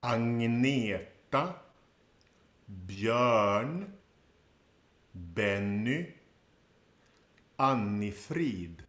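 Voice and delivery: slow, careful Swedish pronunciation of Agneta, Björn, Benny, Annifrid; Swedish names. Shown as a demonstration. Teacher modelling ABBA names for learners, slow Swedish pronunciation. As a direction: model each name slowly, the Swedish way. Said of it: "how we actually say these names in Swedish".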